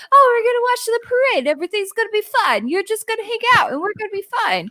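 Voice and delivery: mocking upbeat husband, high and quick; John Travolta. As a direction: imitate a breezy, over-eager partner. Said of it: "Is he John Travolta all of a sudden?".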